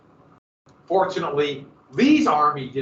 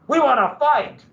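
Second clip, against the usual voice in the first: high, loud demand to fight; eager soldiers' cry; 9 semitones higher, 6 dB louder than usual. Retold or imitated. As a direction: shout it like restless troops. Soldiers voiced demanding battle.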